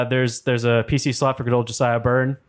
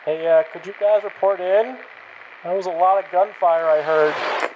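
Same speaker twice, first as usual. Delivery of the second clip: raider on the radio; 6 semitones higher than usual. Acted. NPC over walkie radio.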